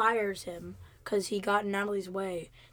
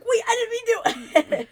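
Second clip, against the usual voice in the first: high, laughing mock protest; giggly high mock apology; laughing imitation; 12 semitones higher, 7 dB louder than usual. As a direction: laugh through her panicked excuse. Natalie after getting guy fired.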